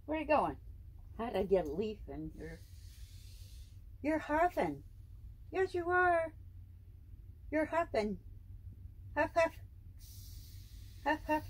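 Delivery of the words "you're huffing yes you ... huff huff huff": high, playful imitating of his huffing. Real, not acted. Owner playfully mimicking her python's huffing in high baby talk.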